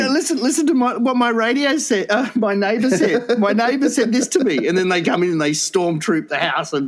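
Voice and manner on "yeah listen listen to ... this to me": high, put-on urgent informant; pitched-up mock urgency; reporting to police. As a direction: play an alarmed caller tipping off police. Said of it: "you go to the police and say".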